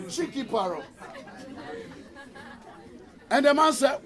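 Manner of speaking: ordinary talk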